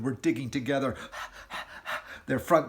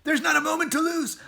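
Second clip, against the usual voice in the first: high, loud burst of inspiration; eager cry; excited shout; 8 dB louder, faster than usual. Acted. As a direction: shout the plan to the family. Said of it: "He jumped up and shouted".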